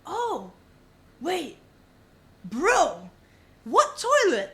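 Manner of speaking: rising shout of sudden alarm; alarmed realization. Retold or imitated. Telling a friend, the moment he realized: alarmed shout. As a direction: blurt it out as it suddenly clicks.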